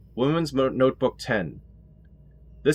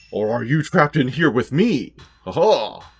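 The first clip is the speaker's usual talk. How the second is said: slow, hammed-up sinister taunt with a cackle; mock villain taunt; 3 semitones higher, 5 dB louder, slower than usual. Teasing the trapped ghost, mock menacing slow taunt. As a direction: play the ghost's threat, slowed and gloating.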